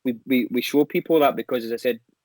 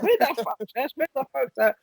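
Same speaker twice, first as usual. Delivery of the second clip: high-pitched indignant retelling; mock outrage; 12 semitones higher than usual. Retelling a man hunting for t-shirts, mock outraged. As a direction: imitate an agitated man, voice pitched way up.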